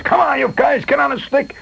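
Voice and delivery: high hollering foreman's yell; yelling at the crew. Retold or imitated. Riley hollering at his mill crew, a high yell. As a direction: bark orders over the steam. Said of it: "he's yelling at the guys".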